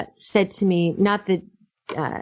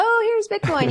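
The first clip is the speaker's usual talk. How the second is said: mock greeting; 13 semitones higher than usual. Restaurant staff greeting, retold.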